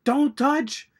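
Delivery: high, loud mock action-hero warning; Jack Slater warning. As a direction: yelp the warning in a put-on voice. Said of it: "and then Jack Slater goes".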